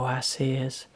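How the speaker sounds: ordinary delivery, nothing marked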